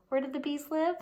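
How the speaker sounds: usual speaking voice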